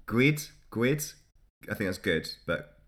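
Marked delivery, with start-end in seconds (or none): 0.1-1.1 "gwid" said with a Scots w, like gweed; "gwid" in a broad Scots way, higher; Scottish gwid. Shown as a demonstration. Tries "gwid" for good reading the Burns poem aloud. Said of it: "attempting something that sounds a bit Scottish".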